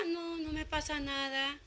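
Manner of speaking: soft, slow, dispirited denial; downcast reply. Retold or imitated. A friend on the phone, denying it in a soft dispirited voice. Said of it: "en ese tono de voz se escucha el desánimo".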